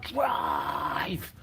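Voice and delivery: long drawn-out imitation of a yell; mock yell. Driver yelling at the man he tailgated, drawn-out mock yell. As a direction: stretch the word out like an angry driver hollering. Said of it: "yelling at me".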